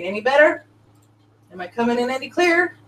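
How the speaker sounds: ordinary delivery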